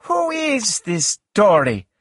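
high-pitched, slow suspicious question. A narrator's reading. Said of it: "suspecting something bad".

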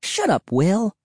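high-pitched, irritated snap; snapping. A narrator's reading. Cassie cuts off Will's mockery, snapping. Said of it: "Cassie snapped".

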